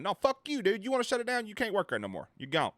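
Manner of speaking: very high-pitched indignant comeback; mock angry retort. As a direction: act out firing someone in outrage. Acted retort to government shutting down.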